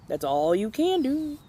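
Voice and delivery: ordinary delivery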